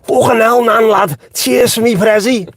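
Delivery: high-pitched, exaggerated Scouse lad; mocking Scouse accent. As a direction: mimic a grating broad-Scouse lad. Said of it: "not everyone sounds like a Starbucks coffee machine".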